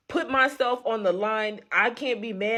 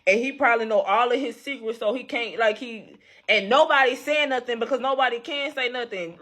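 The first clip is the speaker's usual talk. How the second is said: ordinary talk, nothing marked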